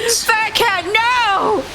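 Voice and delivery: high panicked call; alarmed high cry. Real, not acted. Cat drowning in game.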